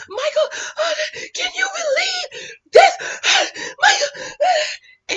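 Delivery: very high wailing sob-voice, calling him; hammed-up tearful plea; mock crying to husband. Acting herself upset to husband.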